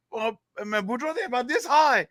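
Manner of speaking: very high Cajun sidekick; comic squeezed-up voice; Cajun joke character. Acted. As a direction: play the fretful Cajun reporting. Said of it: "get into this character here".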